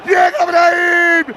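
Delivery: shouted slow goal recap; breakneck goal commentary. Real, not acted. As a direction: call the replay with fire. Shouted goal replay narration.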